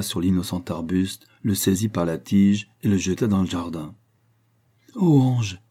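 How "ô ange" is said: higher cry of adoration; impassioned cry. A narrator's reading. Young woman embraces her lover, impassioned cry. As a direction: exclaim it with loving fervour. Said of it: "s'écria-t-elle".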